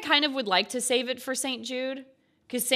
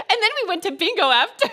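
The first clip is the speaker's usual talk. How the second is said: high, fast, laughing through the words; giggling as she tells it; 9 semitones higher, 5 dB louder, faster than usual. Real, not acted. Streamer laughing at her own joke to chat.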